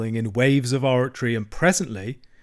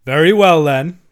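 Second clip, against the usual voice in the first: loud, higher, resolute; firm; 4 semitones higher, 11 dB louder than usual. A narrator's reading. Badger answering Toad's defiance, firm. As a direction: announce it firmly and forcefully. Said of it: "said the badger firmly".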